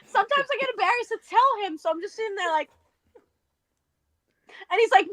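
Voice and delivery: ordinary talk, nothing marked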